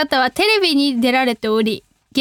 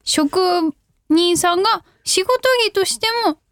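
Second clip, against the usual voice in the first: ordinary talk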